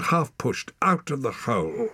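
usual speaking voice

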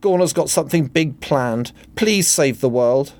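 high-pitched damsel's plea; crying out. A narrator's reading. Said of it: "cried Poppy".

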